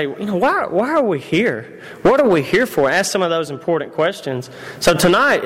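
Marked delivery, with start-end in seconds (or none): 0.0-2.9 slow searching question. Retold or imitated. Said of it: "step back and say".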